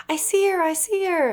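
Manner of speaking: high-pitched imitation of excited kids; mock screaming. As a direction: squeal it like a child, without full volume. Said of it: "they were screaming".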